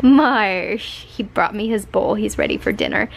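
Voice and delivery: normal talking voice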